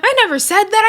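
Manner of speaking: high put-on whiny denial; mock denial. [name] denying to [name], high mocking whine. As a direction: squeak out a fake innocent denial.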